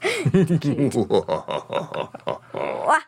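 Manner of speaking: low evil cackle; deep villain laugh. Pretend. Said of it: "like the evil laugh".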